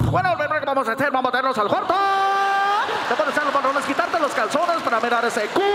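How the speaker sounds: DJ patter climbing to high cries; radio host voice. Acted. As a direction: announce bedroom business like a radio ad. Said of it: "goes home talking like that".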